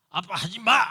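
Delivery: put-on loud child's command to dad; imitating a son ordering his father; bossy son. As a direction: bark it like a spoiled kid.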